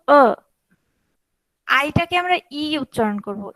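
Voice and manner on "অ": অ from the throat, mouth only a little open; Chinese e sound. Shown as a demonstration. Teacher modelling অ for students, from the throat. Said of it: "অল্প open করে গলার ভিতর থেকে বলবো".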